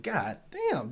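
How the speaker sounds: usual speaking voice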